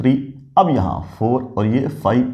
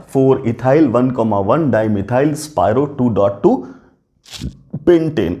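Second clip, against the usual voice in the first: very slow, spelling out each part of the name; slow dictation; much slower than usual. Shown as a demonstration. Teacher slowly dictating the compound name to students. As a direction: dictate it word by word so students can write. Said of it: "क्या नाम हो गया पूरा?".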